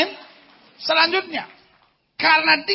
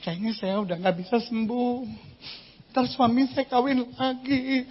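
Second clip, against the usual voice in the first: much softer, self-pitying murmur; mock despair; 8 dB quieter than usual. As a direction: play a gloomy patient giving up. Imitating a despairing sick woman. (keluhan pelan yang pasrah)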